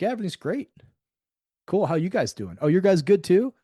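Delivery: bright, high, hollow "all good" chatter; mock breezy small talk. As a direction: imitate empty checkout-line chitchat. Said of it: "Like the supermarket".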